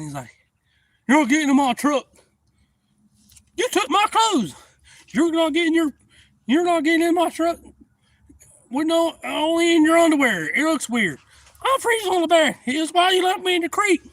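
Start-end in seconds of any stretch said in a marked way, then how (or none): 1.1-7.6 high, loud quarrel; acted angry yelling. Buddies fighting over getting in the truck, acted angry yelling. 8.7-14.0 high, loud bickering; heated acted argument. Freezing man blaming him for the creek, heated acted argument. Quarrel about freezing on back.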